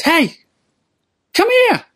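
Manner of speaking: put-on very high falsetto old man; little old man calling. Old man summons the drunk tramp, high falsetto call. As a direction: beckon in a piping, high-pitched voice. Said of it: "it's a little old man".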